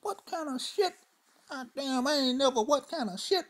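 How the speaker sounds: high-pitched indignant disbelief; outraged yelp after being slapped; stunned outrage. Retold or imitated. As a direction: squeal it out, shocked and offended. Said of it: "Said this to myself".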